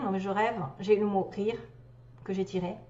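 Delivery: normal talking voice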